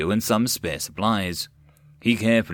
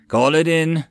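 higher, louder quick command; quick order; 6 semitones higher, 4 dB louder than usual. A narrator's reading. Officer ordering his radio men, quick command. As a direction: bark it fast. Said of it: "he quickly ordered".

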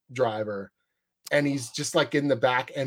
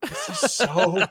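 slow, higher, laughing aside; delighted; 6 semitones higher, much slower than usual. Retold or imitated. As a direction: savor it through laughter. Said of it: "I'm like".

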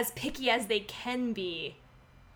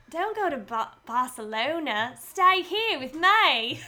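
drawn-out, higher Australian pleading line; slow, broad Australian; Australian accent; 3 semitones higher, 4 dB louder, slower than usual. Shown as a demonstration. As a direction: stretch the vowels in an Australian accent. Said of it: "I have to think of a line".

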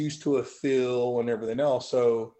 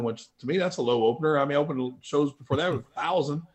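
ordinary talk

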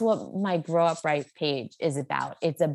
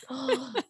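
mock gasp; 6 semitones higher, 6 dB quieter than usual. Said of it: "And I'm like".

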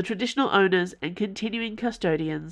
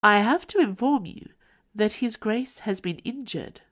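creepy formal house; 5 semitones higher than usual. Acted. The house informing Mother in a creepy formal tone. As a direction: announce it like a cold formal servant. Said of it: "in that creepy formal tone it employed".

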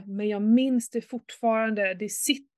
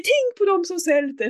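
higher, louder nagging parent; mock parental lecture; 8 semitones higher, 6 dB louder than usual. Mother parodying a guilt-tripping lecture, raised. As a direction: lay on the guilt trip. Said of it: "lite så här".

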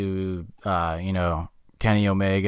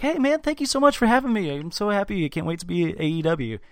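much higher, sugary eager voice; fake nice MJF; 8 semitones higher than usual. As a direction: suck up with a high fake cheer. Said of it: "he's always like".

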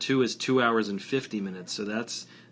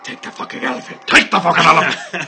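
Liam Neeson line; 6 semitones higher, 10 dB louder, faster than usual. Retold or imitated. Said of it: "Or the, uh".

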